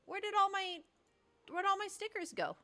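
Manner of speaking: high-pitched whiny question; whiny dismay. Real, not acted. Searching the app for lost stickers in a high whine.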